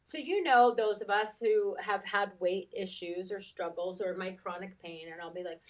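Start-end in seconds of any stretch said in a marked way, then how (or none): none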